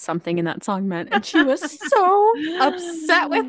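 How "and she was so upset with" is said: high, loud and drawn out through laughter; amused, laughter-filled exclamation; laughing retelling. Real, not acted. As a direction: tell it cracking up, slowly. Laughing while retelling mom's upset.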